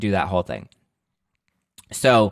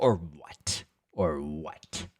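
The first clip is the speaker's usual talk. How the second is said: quiet taunt; 5 semitones lower, 8 dB quieter, much slower than usual. Pretend. Speaker imagined squaring up.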